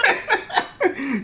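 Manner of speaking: real laugh jumping high; sudden high-pitched real laugh; high burst of laughter. Real, not acted.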